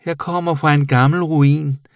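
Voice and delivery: high, loud little creature voice; proud bat. A narrator's reading. Bat telling the boy its origins, proudly. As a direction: announce it proudly, high-pitched. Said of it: "sagde flagermusen stolt".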